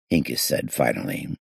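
usual speaking voice

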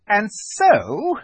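high, loud imperious witch; witch resuming. A narrator's reading. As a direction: pick up haughtily as the witch. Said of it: "continued the witch".

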